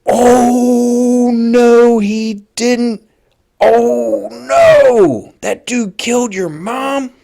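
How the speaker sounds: very high, loud gasping disbelief, slowing at the end; shocked disbelief. Retold or imitated. Narrator hearing the accusation at the guard shack, stunned high cry. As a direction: gasp it out like you can't believe your ears. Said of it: "I was completely shocked".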